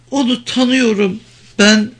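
tiny high voice, shaken; frightened, very high small voice; thin, trembling. A narrator's reading. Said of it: "Vicky'nin sesi incecikti, konuşurken titriyordu".

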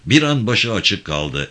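usual speaking voice

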